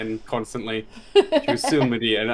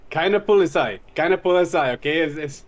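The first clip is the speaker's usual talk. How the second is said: broken German; 5 semitones higher, 3 dB louder than usual. Retold or imitated. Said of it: "Try and communicate that in German".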